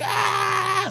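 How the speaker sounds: high-pitched imitation shriek; mock wail. Driver protesting at the traffic cop, mock high wailing. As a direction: let out a squealed, hammed-up wail.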